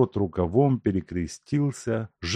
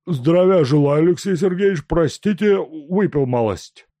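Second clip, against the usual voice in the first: high, loud bowing peasant voice; fawning tipsy peasant, high and loud; humble drunk peasant; 8 semitones higher, 7 dB louder than usual. Acted. Drunk peasant greets official.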